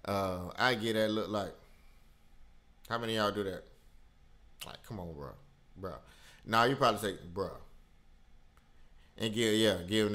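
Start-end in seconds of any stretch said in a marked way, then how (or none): none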